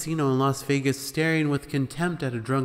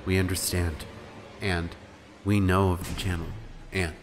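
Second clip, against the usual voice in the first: lower, calm reassurance; reassuring; 6 semitones lower than usual. A narrator's reading. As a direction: soothe the frightened caller. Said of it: "they kept saying in reassuring voices".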